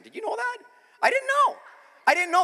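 very high, loud squeal of surprise; hammed-up excited disbelief; mock amazement. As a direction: play astonished, voice shooting way up. Joking amazement about Jesus the fan.

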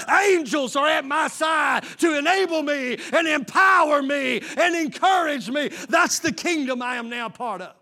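loud, rising preacher cadence; Sunday-morning pulpit fire; preaching. Acted. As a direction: preach it out to the whole church. Preaches at full force to congregation.

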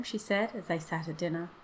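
ordinary delivery, nothing marked

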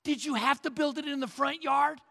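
put-on embarrassed kid griping at Dad; mock whiny teen; whiny teenager. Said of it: "Imagine the teenagers".

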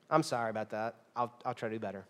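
low, soft, apologetic reply; meek apology. Acted. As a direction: give in quietly as the chastened spouse. Acted apology to frustrated spouse.

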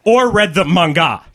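"manga" said with heavy, pedantic emphasis; over-correct pronunciation. A narrator's reading. Nerdy man correcting himself to his date, "manga" over-pronounced. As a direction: lean hard on pronouncing "manga" just so. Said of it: "emphasis he put on pronouncing manga correctly".

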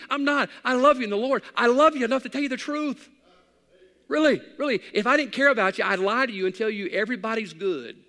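loud, high preaching cadence; fiery sermon. Acted. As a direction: preach it hard to the congregation. Preaching at full force.

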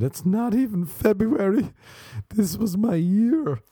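high, drawn-out mock wail; half crying, half screaming. Imitating girl on the phone over broken vision board, half crying half screaming. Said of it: "half crying, half screaming".

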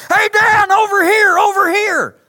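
high, very loud holler; shouted call. Acted. God as everybody else, hailing Dan, shouted call. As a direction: yell to grab someone's attention. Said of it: "we expect God to be like everybody else".

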